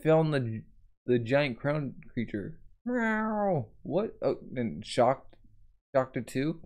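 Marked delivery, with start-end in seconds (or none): 2.9-3.7 high mock meow; cat meow. Imitating monster cat.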